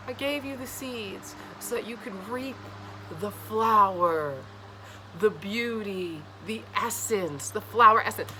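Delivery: slow, dreamy, drawn-out words; lightly voiced patient god; God explaining. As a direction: savour the flower essence slowly. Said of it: "this is the God telling you".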